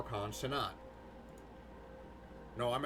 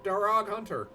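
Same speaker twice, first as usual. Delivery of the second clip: put-on a light Irish accent reading the name; 6 semitones higher than usual. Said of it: "didn't even mean to read that in Irish accent".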